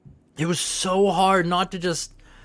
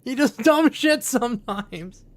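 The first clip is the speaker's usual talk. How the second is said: exasperated mom; 6 semitones higher than usual. Retold or imitated. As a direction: question the child in disbelief. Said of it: "It's like".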